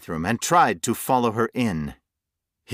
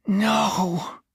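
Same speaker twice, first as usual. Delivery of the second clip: weeping; 13 semitones higher than usual. A narrator's reading. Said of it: "Jonathan wept".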